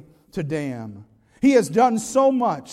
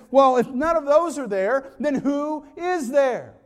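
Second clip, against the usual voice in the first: mock call to Saint Peter; 5 semitones higher, 4 dB louder, slower than usual. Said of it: "mocked this idea of God".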